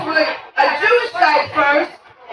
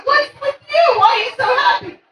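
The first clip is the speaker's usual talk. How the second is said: high-pitched baffled teacher; surprised questioning; 7 semitones higher, faster than usual. Acted. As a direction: ask in high, incredulous disbelief. Teacher questions unusually happy kid.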